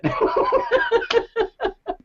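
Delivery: a long high laugh; high falling laugh. Real, not acted. Laughing after the story.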